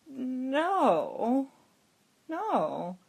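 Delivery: imitating a convincing innocent act; convincing denial. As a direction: deny it as if sincerely innocent. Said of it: "I said it so convincingly".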